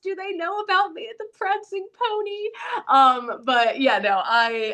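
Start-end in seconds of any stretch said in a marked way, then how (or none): 0.0-2.5 high, gushing put-on wonder; playful fantasy-fan voice. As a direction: ask like a starry-eyed fan. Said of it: "I'm like".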